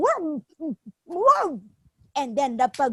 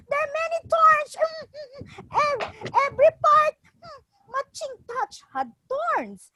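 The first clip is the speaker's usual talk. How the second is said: high, fast pained monkey; 12 semitones higher, faster than usual. Acted. Monkey in pain.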